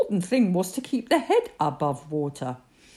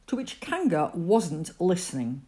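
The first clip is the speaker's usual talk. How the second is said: ordinary delivery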